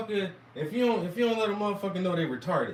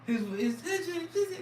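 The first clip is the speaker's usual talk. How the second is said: very high squeaky mocking stammer; Ben Shapiro. Commentator mocking a debater's high-pitched voice, squeaky mimicry. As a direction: squeak it out in mockery. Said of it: "his voice is so high pitched".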